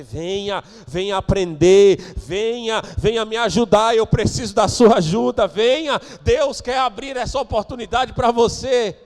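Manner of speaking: loud preacher's call to come; apelo inflamado. Real, not acted. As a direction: urge them forward in rising, forceful calls. Apelo do pregador. (apelo de pregador em voz alta)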